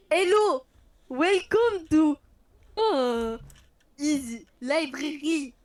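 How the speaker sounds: loud, deliberate French-tinged English accent. Shown as a demonstration. Player showing friends her English, put-on English accent. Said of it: "vous montrer mon accent anglais".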